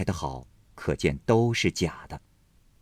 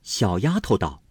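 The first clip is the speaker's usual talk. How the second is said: usual speaking voice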